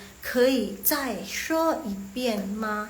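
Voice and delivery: slow, spaced-out Mandarin question; slow model reading. Shown as a demonstration. Teacher shows how to ask for a repeat, slow model reading. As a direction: give each syllable its own beat. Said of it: "ini Mandarinnya".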